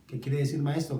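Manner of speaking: normal talking voice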